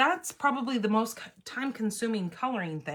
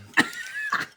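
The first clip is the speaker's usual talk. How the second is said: short high laugh; burst of laughter. Real, not acted. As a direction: laugh out suddenly. Laughs at partner quitting.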